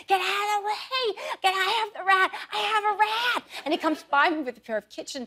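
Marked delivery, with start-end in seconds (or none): 0.1-3.4 imitating husband's frantic high yell; husband screaming. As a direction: scream it like he's caught a rat. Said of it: "starts screaming at the top of his lungs".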